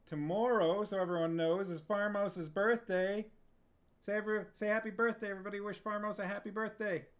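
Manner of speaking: loud and excited; cheerful loud announcement. Real, not acted. Announcing streamer friend's birthday.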